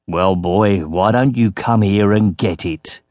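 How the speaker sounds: low-pitched menacing dare; taunting. A narrator's reading. Yuri dares the boy to come get it, taunting. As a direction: dare him, voice dropped low.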